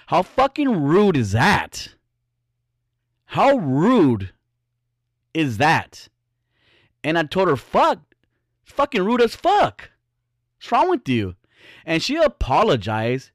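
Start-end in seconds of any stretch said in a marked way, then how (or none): 0.1-6.1 slow, high indignant disbelief; offended, stretching out each word. Real, not acted. Indignant over a stranger's rude remark. 7.6-11.4 high-pitched mock outrage; acted-out scolding, voice way up; retold indignant rebuke. Retelling his rebuke to the girl.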